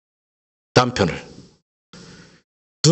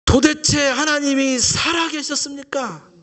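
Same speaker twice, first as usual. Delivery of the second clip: 1 pleading question; 11 semitones higher than usual. Retold or imitated. Moses questioning God.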